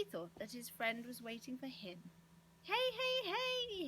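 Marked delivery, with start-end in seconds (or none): 2.6-3.9 high, loud hailing yell. A narrator's reading. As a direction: yell out high to get attention. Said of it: "he yelled".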